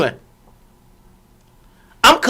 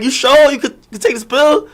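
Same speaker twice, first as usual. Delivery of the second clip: retold insistent urging; 8 semitones higher than usual. Streamer replays pressing the woman about the pill, high-pitched urging. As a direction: urge her in a high, incredulous retelling.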